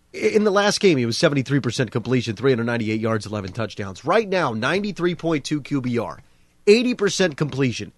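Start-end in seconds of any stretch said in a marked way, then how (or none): none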